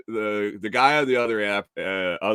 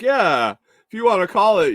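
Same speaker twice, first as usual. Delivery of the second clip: mock helpful; 9 semitones higher, 6 dB louder than usual. Offering the phone to lady.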